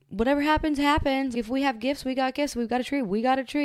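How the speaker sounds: breezy, high, shrugging tone; carefree. Retold or imitated. Recalling her younger carefree self at Christmas, breezy. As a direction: toss it off like nothing matters.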